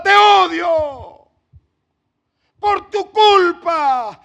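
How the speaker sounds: loud, very slow, drawn-out cry; girl's scream. Retold or imitated. Girl screaming at her father in the retold scene. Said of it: "su grito me queda siempre en la mente".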